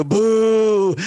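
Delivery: a high, drawn-out mock boo; a playful booing jeer; imitated crowd boo. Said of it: "And people would boo".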